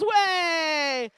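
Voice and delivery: long, high, very loud whoop; cheering. Real, not acted. Host leading a round of applause, loud cheer. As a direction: lead the crowd's cheer with a held shout.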